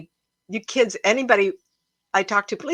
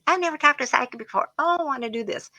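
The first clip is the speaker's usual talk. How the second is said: high, eager young couple imitation; giddy, giggly; 7 semitones higher, faster than usual. Psychic retells a giggling young client's words, giddy. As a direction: imitate giddy excitement in a higher voice. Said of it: "They're just giggling".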